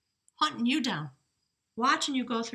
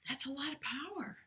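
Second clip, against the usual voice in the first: real hushed whisper, much softer; awed whisper; 4 semitones higher, 10 dB quieter than usual. Real, not acted. Reader reacting to the rune's power, awed whisper. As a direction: whisper it as an aside to yourself. Awed aside after reading.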